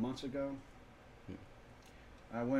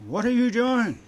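mock alarmed yell; 10 semitones higher, 12 dB louder than usual. Retold alarmed protest.

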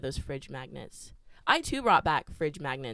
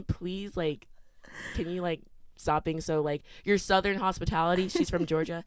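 ordinary talk

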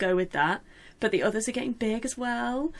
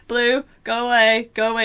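shooing; 2 semitones higher, 6 dB louder than usual. Retold or imitated. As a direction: shoo the horse away. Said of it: "I was like".

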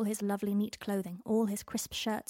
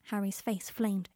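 plain reading voice

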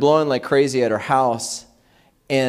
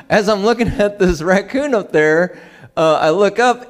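usual speaking voice

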